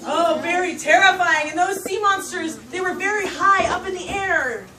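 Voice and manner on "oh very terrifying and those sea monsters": ordinary delivery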